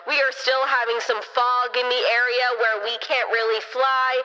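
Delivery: low, monotone announcer over a PA; mock gate agent on the intercom; airline intercom announcement. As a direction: deepen the voice into a muffled intercom drone. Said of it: "I hear on the intercom".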